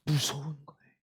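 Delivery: ordinary talk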